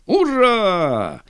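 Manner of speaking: high, stretched hurrah; hearty cheer. A narrator's reading. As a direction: cheer it like assembled officers. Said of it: "дружно и коротко отозвались офицеры". (радостный клич «ура»)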